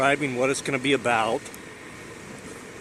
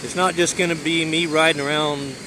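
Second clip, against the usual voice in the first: shouting over wind; 4 semitones higher, 3 dB louder than usual. Real, not acted. Talking while riding in wind.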